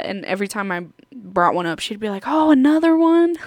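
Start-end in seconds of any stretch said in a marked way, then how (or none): 2.3-3.4 high, louder, gushing surprise; super nice old lady. Retold or imitated. Old lady cashier receiving another item, super nice. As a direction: imitate a delighted old cashier. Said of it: "she was super nice".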